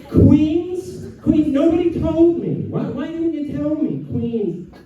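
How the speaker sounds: put-on very high, complaining Queens accent; whiny Queens accent. Acts out an aggrieved Queens local.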